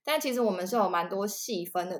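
ordinary delivery

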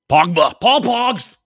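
high, drawn-out cheer of a player's name; playful high-pitched hype call; excited name call. Pretend.

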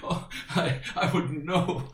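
words breaking up with laughter; laughing. Real, not acted. Telling the hosts about a silly guest's joke, laughing. Laughs through his own anecdote.

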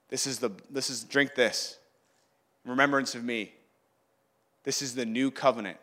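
low, soft and measured; hushed, reverent statement; solemn words. Acted. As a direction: say it quietly, as at a sacred meal. Jesus speaking at the Last Supper.

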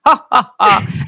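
slow, loud fake "ha, ha, ha"; mock laugh. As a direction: spell out a smug laugh slowly. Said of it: "laughed and he was like".

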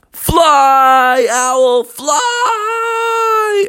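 high, long drawn-out crowd cry; cheering on. Acted. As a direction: call it out to urge a flier on. Teammates cheer the owl on.

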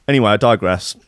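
ordinary talk

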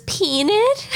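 high mock stewardess offering peanut; high put-on flight attendant asking. Stewardess voiced in retold movie scene.

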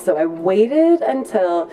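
ordinary talk

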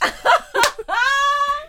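high burst of laughter; loud laugh. Real, not acted. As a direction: let out a big laugh. Reacts to the joke.

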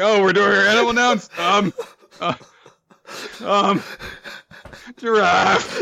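high, fast, unsure voice stalling for an answer; hesitant guesser. Retold or imitated. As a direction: stall awkwardly, then blurt the guess. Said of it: "He's like".

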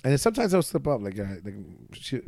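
ordinary talk

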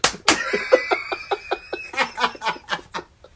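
high, wheezing burst of laughter; real breathless cackle; helpless laugh. Real, not acted. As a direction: laugh until the words give out. Helpless laughter at own story.

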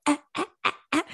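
high little coughing cries; newborn coughing cry. Retold or imitated. Imitating the newborn's cry, high coughing ahs. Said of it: "She's kinda like".

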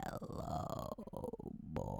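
breathy whispered greeting; creepy lady greeting the boy, whispered; weird witch voice. Retold or imitated. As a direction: whisper it eerily like a creepy stranger. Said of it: "she speaks to him very weirdly".

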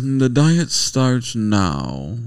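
very slow, lofty pig voice; sophisticated pig. Retold or imitated. Husband voicing the sophisticated pig in Peter Rabbit, lofty and slow. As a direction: pronounce it like a pompous pig. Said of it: "sophisticated looking pig".

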